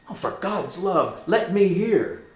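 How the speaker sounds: high, urgent plea; impatient plea. Acted. Hamlet demanding the story, high and impatient. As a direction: beg to hear it now.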